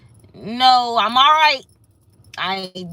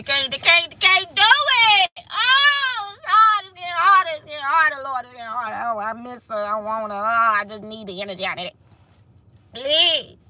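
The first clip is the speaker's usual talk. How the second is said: mock wailing; 2 semitones higher than usual. Mimicking rivals who lose the waiting game, mock wailing.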